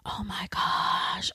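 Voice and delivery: slow, stunned whisper. Real, not acted. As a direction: breathe it out, slow and amazed. After a sudden gasp.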